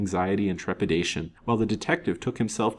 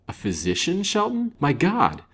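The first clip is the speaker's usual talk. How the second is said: rising to a high, loud exclamation; shocked; 12 semitones higher, 4 dB louder than usual. A narrator's reading. Banker learns a physician took the body, shocked. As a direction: react in appalled disbelief.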